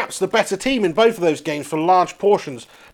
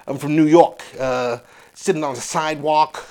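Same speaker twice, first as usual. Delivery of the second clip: lowered New York wise guy; New York accent; 4 semitones lower, 4 dB quieter than usual. Acted. Showing how New Yorkers talk, New York accent. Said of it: "New York City. They sort of like".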